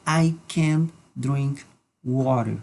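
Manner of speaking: slow, careful English example; slow model sentence. Shown as a demonstration. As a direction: spell it out slowly for learners. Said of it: "simplemente podemos decir".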